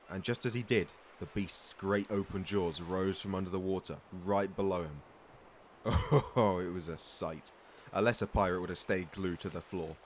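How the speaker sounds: a pirate spinning a tall tale, with relish; storytelling. Acted. Pirate telling a greenhorn his monster tale with relish. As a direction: build the legend for the listener.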